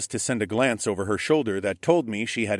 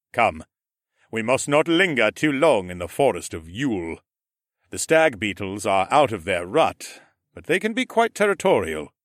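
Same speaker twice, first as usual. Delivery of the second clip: Mech's voice; 4 dB louder than usual. A narrator's reading. Mech explaining Amaya's nature to Asher, measured. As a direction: speak as the calm Ram Lord explaining.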